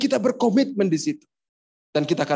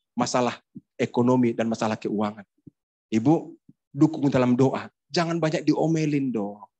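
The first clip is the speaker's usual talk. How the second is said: normal talking voice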